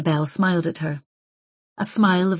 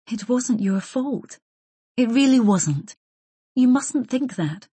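slow, higher-pitched comforting voice; earnest reassurance; 6 semitones higher, slower than usual. A narrator's reading. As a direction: stretch it out to soothe and convince. Comforting a sobbing friend.

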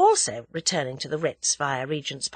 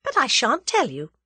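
gloating, higher-pitched refusal; smug little victory, pitched up; triumphant; 6 semitones higher, 4 dB louder than usual. A narrator's reading. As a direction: withhold it triumphantly. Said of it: "said Tuppence triumphantly".

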